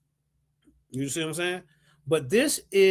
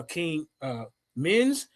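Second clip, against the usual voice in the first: usual speaking voice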